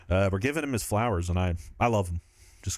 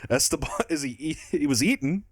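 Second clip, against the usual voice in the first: higher, mock German accent; hammed-up German accent with a raised pitch; 6 semitones higher than usual. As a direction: quote Dafoe in a German accent. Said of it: "but in the German accent".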